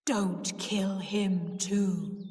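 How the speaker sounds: very slow, deep ghostly plea; deep, haunting warning. A narrator's reading. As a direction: intone it slowly, every word drawn out. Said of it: "she said in a deep voice".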